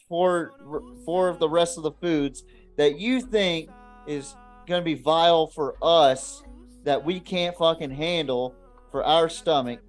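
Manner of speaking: ordinary talk, nothing marked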